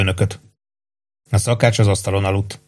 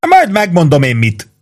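indignant, pitched-up comeback; affronted cook, voice raised in pitch; 8 semitones higher, 4 dB louder than usual. A narrator's reading. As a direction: answer back in a huff. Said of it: "mondta méltatlankodva a szakács".

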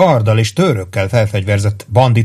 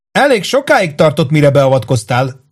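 put-on high-pitched, coolly put out girl; understated cold rebuke, pitched high; cold reproach; 5 semitones higher than usual. A narrator's reading. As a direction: coolly reproach your late rescuer in a higher voice. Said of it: "mondta hidegen a lány".

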